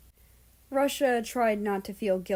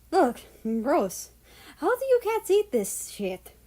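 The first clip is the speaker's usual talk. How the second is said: disgusted; 7 semitones higher than usual. Acted. Russia gags at cat food.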